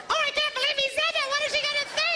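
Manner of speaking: high, fast mock gasp; mock shocked women. Pastor mimicking shocked women in the audience, high and fast. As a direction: play the scandalised ladies, high and quick. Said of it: "All the girls".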